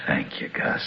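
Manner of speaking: low, breathy mocking whisper; gloating whisper. Van Allen sneering at the dying doctor, cruel whisper. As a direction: hiss your thanks with cruel relish.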